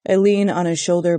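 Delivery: plain reading voice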